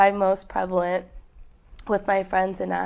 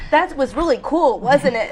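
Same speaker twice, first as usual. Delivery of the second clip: mildly hammed-up higher quote; gushing interviewer; 5 semitones higher, 4 dB louder than usual. Retelling the interviewer's "cool", lightly mimicked higher voice. Imitating interviewer using overused word.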